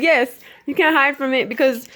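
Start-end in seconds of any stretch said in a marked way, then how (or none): 0.0-0.4 loud, high imitated "yes"; uncle's second voice. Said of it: "His second voice said".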